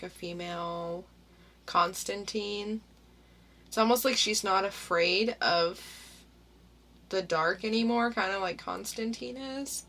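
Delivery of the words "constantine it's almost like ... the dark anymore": ordinary delivery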